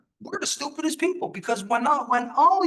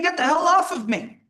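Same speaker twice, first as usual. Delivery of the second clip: higher, louder mock plea; a woman crying out to get off her, higher and louder; imitated victim's cry; 6 semitones higher, 5 dB louder than usual. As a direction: voice her cry for help, pushed up. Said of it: "And you hear her saying".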